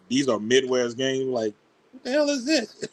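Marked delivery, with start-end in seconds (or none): 1.9-2.8 high, baffled mock outburst. Friend mocking Midwest games, baffled high voice. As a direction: squeak out the confusion.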